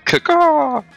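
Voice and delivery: shrieking high chicken caw; mock chicken. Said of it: "imitation of a chicken".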